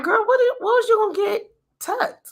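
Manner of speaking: high, stunned at her past self; incredulous self-scolding. Retold or imitated. Looking back at old photos, scolding herself in disbelief. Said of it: "I'm like".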